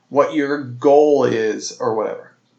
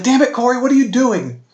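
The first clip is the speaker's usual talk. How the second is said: much higher, louder mock-frustrated yell; competitive gamer yell; 11 semitones higher, 5 dB louder than usual. Player yelling at partner Corey for a game mistake, loud and high. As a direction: blow up at a teammate over a game. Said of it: "video game competitive yelling".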